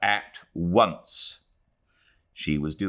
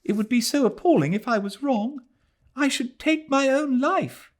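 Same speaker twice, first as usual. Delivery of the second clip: put-on high, fast, distressed girl's voice; fiancée afraid she is wrong; anxious young woman. A narrator's reading. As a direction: rush out the dread. Adela's anxious plea.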